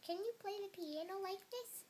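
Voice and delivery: high, light boastful question; crying out. A narrator's reading. Toad showing off piano to Frog, high-pitched cry. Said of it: "cried Toad".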